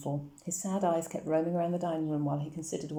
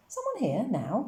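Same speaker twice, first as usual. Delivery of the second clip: much higher, startled question; surprised father; 7 semitones higher, slower than usual. A narrator's reading. Papa emerging from his room, startled and much higher. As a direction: caught off guard while dressing. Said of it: "said Papa".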